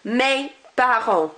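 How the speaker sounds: Mes parents slowly, no S or T sounded; silent endings. Shown as a demonstration. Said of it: "I'm not pronouncing them".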